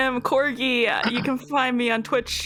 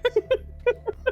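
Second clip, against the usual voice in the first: laughing; 12 semitones higher, faster than usual. Real, not acted. Laughing while repeating herself.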